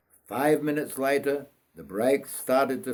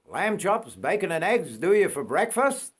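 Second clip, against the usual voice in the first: higher-pitched steward voice; steward offering breakfast; 6 semitones higher than usual. Acted. Steward offers the boy breakfast, higher voice. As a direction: voice the steward a good bit higher.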